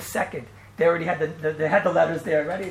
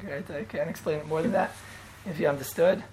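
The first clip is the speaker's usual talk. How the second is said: ordinary talk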